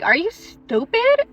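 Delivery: high, drawn-out disbelieving question; mock incredulity. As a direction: stretch it out as if he's an idiot. Incredulous retort to boyfriend, retold.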